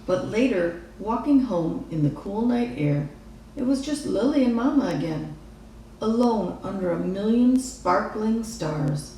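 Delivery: usual speaking voice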